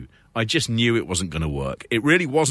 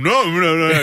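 higher, louder mock panic; panicked no; 6 semitones higher, 6 dB louder than usual. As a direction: blurt out a frightened refusal. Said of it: "had he sort of gone".

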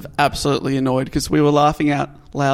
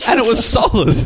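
laughing; 6 semitones higher than usual. Real, not acted. Laughing while describing being sick.

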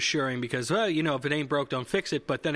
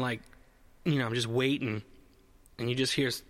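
usual speaking voice

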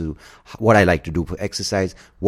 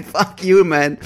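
loud, fed-up comeback; annoyed retort; 11 semitones higher, 5 dB louder, slower than usual. Retold or imitated. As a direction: snap back at the ad.

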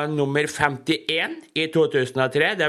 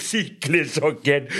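chuckling through the words; amused laugh at the comic; real laugh, then the words; 3 semitones higher than usual. Real, not acted.

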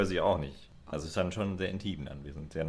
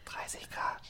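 unvoiced whisper as the assistant; whispered reply; 8 dB quieter, much slower than usual. Friend plays Alexa, whispering the weather back. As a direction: answer in a breathy secret whisper.

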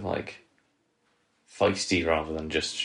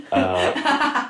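a much higher, louder burst of laughter; laughing squeal; 6 dB louder than usual. Real, not acted. Cohost cracking up repeating for me, high squeal laugh. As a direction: crack up mid-phrase.

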